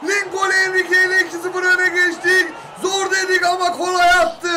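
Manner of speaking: fast excited play-by-play commentary; turkish football commentator. Acted. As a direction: call the match live like on TV. Live play-by-play of a match.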